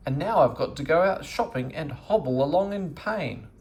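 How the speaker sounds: lightly voiced grumpy old woman scolding her cat; a hint of a cross old dame, complaining; grumbling at the lazy cat, mildly cross. A narrator's reading.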